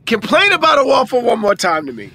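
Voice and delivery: loud, high mock threat; acted threat. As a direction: dare them like an irate cafeteria worker. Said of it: "One of them was like".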